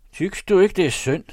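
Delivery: high, loud wheedling complaint; complaining poacher. Acted. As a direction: appeal for pity, pitched high. (klagende krybskytte med høj stemme)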